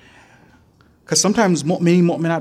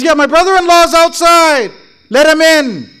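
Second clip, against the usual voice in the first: loud, higher mock Kirmani accent; imitating a Kirmani accent; 9 semitones higher, 8 dB louder than usual. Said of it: "he spoke in a Kirmani accent".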